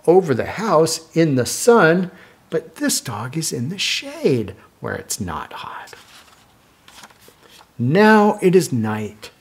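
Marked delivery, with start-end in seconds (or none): 2.5-5.9 soft unvoiced whisper. Whispering to a child over the picture book. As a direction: breathe it out secretly to the child.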